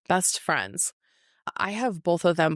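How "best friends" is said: air quotes. Real, not acted. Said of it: "we're gonna air quote here".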